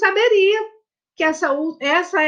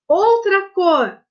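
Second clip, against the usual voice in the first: "outra" slow, higher and louder; stress on outra; 4 semitones higher, 4 dB louder, much slower than usual. Shown as a demonstration. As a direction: hit "outra" slowly, spelling out the rule. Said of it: "teacher stressing a rule". (ênfase lenta e forte em "outra")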